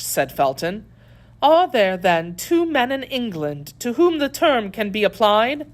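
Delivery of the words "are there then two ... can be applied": high, emphatic rhetorical question; indignant lady's challenge; scornful lady prisoner. A narrator's reading. Voices Milady scornful question.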